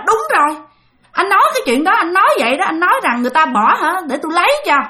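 pin him down with his own words; accusing recall. Retold or imitated. Aunt reminding the uncle of his boast, accusing. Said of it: "Thì mở nó".

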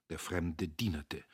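ordinary delivery, nothing marked

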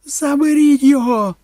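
very high, loud panicked scream. A narrator's reading. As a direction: scream for someone to pull the child off. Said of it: "репетувала Кетрін". (репетуючи)

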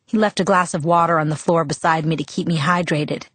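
ordinary delivery, nothing marked

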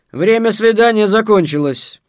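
high, loud formal announcement; official interruption. A narrator's reading. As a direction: cut in loudly with authority. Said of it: "вмешалась помощница маршалессы".

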